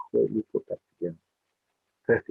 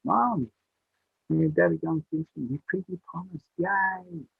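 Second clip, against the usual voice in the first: put-on high, excited child's voice; a little boy bursting with joy; squealing for joy; 8 semitones higher, slower than usual. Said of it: "he was screaming for joy".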